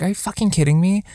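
high, fast incredulous outburst; exasperated disbelief. Retold or imitated. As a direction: fire it off in disbelief. Exasperated quote to date.

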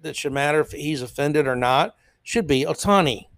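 ordinary talk, nothing marked

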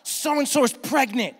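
high, loud gushing news; excited wife. Retold or imitated. Wife excitedly shows husband baby news. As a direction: blurt out the news, thrilled. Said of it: "she'll scream".

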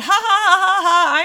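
loud maniacal villain laugh; cartoon laugh. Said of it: "the cartoonish laugh".

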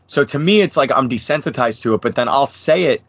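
ordinary talk, nothing marked

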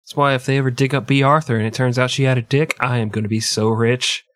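ordinary talk

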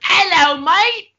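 high, loud jokey British voice; hammed-up London greeting; a cheeky fake Cockney hello; mock English accent.

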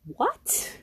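disbelief. Retold or imitated. Disbelief at being overcharged.

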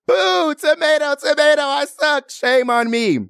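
high, drawn-out playful jeer; mock heckling. Host heckling herself over failing the test, mock jeer. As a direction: play a crowd booing you off stage.

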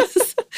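high giggle away from the mic; laughing off mic. Real, not acted.

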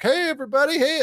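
cheery playboy greeting. Retold or imitated. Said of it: "he meets people like".

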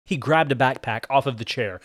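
plain reading voice

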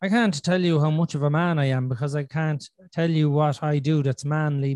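normal talking voice

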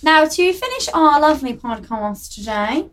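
hammed-up posh presenter; posh accent. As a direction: announce it in a mock posh accent. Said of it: "to do a posh accent".

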